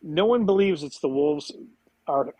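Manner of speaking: ordinary delivery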